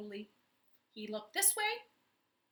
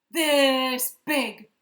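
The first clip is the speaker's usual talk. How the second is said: much louder cry; cried out; 3 semitones higher, 16 dB louder than usual. A narrator's reading. Chimpanzee cries out to elephant, loud. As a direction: belt it out, arms wide. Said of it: "he cried".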